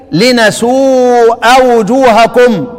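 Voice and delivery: slow recitation of لنسوء with nun, drawn out; Kisai's reading. Shown as a demonstration. Teacher giving al-Kisai's reading to class, slow recitation. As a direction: chant لنسوء وجوهكم slowly with a clear nun. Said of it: "طب والكسائي؟".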